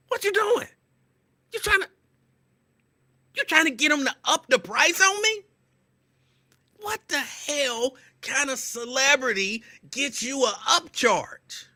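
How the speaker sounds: high, slow incredulous protest; indignant disbelief. Retold or imitated. Retorting to the clerk about the upcharge, high and incredulous. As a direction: sound outraged he's raising the price.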